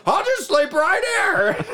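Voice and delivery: high, slow, laughing mock husband. Husband on the wet floor, high and laughing. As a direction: choke out a laugh while conceding.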